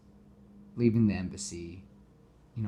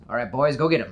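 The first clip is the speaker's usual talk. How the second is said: gang boss order; 3 semitones higher, 8 dB louder, faster than usual. Retold or imitated. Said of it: "And Toombs goes".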